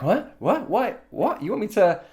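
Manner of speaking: rising, puzzled repeated whats; baffled mock reaction. Imitating a listener confused by 'break a leg', rising puzzled questions. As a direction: play someone thrown by the phrase, asking back in disbelief.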